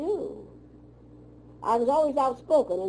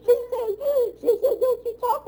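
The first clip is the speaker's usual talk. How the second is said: high and laughing through the words; 4 dB louder than usual. Real, not acted. Retelling her mother's scolding, still laughing. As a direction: keep laughing as you quote her.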